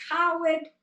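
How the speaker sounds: high, loud shout of "Coward!"; angry boy shouting; angry yell. Retold or imitated. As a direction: hurl the insult across the valley. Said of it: "he yelled".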